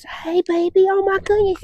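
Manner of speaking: put-on very high gushing baby talk; high praise voice. Shown as a demonstration. Trainer demoing to owner the high excited praise to avoid. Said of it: "high praise, high voice".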